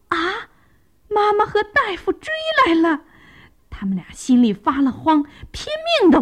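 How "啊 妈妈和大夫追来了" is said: put-on high-pitched childlike alarm; acted little-animal panic; panicked cry. A narrator's reading. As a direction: cry out that you're being chased. Fleeing youngsters spot their pursuers.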